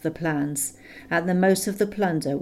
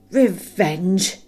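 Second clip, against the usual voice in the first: loud scornful snort of "Revenge!" rising; 3 semitones higher, 6 dB louder than usual. A narrator's reading. Smaug mocking Bilbo's word, loud rising snort. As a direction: spit "Revenge!" back mockingly with a rise. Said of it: "he snorted".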